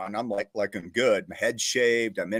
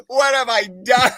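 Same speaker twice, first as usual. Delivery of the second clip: dismayed wail; 10 semitones higher, 7 dB louder than usual. Retold or imitated. Recruit regretting joining.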